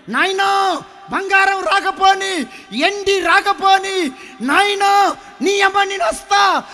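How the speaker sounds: very high, loud, slow insistent call; sin promising to follow. Acted. Sin answers the dying father: high, slow, loud promise. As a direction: call out that you will come no matter what.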